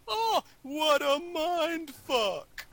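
very high, slow, drawn-out cry of disbelief; stunned exclamation. Retold or imitated. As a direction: stretch out the Oh in shock. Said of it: "And then he goes".

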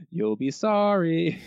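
spooky shopkeeper with the monkey's paw, hammed-up; ominous shopkeeper. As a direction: drag it out like a cursed shopkeeper. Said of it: "The shopkeeper and the monkey's paw".

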